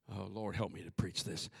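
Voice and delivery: low, hushed aside to God; murmured prayer. Real, not acted. Preacher praying for help mid-sermon, low murmured plea. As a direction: drop to a soft, low murmur.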